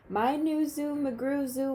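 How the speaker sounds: usual speaking voice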